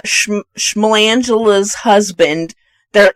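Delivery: ordinary talk, nothing marked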